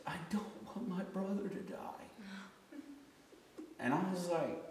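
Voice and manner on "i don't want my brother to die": put-on high, soft child's plea; imitated weeping. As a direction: plead softly like a tearful little boy. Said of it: "Enoch actually started weeping".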